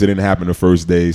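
ordinary talk

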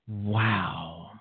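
ordinary talk

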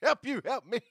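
laughing quote. Retold or imitated. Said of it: "Show me the money, Jerry".

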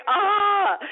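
high-pitched mock swoon of a fan; exaggerated, high 'oh' imitating an overexcited admirer; overwhelmed fan. Host mimics the guest's silent admirer, mock overwhelmed. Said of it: "He's like".

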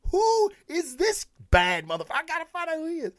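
high-pitched, hammed-up incredulous reaction; amazed curiosity. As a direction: voice jumps way up, replaying your astonishment as a fan. Acts out own amazed reaction.

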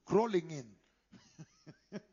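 drawn out very slowly through a laugh. Real, not acted. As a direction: let the laugh take over the words. Laughs at his own image.

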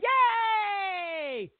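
high squealed yay; a hammed-up cheer; mock cheer. Mocking the winner announcement.

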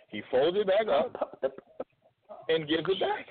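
rising in disbelief at the wallet returned; mock disbelief. Wallet handed back, disbelief.

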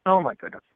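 sharp real cough, then a breathless "oh my goodness"; coughing up tea, still recovering; coughing fit. Real, not acted. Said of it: "my tea went down the wrong pipe".

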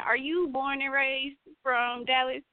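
ordinary talk

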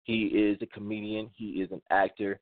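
ordinary talk

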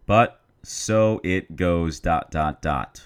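"But so it goes" drawn out very slow; "dot, dot, dot" spoken slowly and deliberately; slow dramatic ellipsis. Shown as a demonstration. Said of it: "Or is it dot".

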